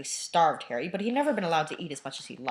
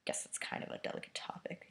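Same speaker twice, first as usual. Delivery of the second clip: whispering; 13 dB quieter than usual. Real, not acted. Whispered aside.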